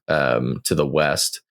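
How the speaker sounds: ordinary talk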